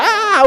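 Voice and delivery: high-pitched acted shriek; hammed-up frightened scream; mock scream of fear. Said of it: "se pusieron a gritar".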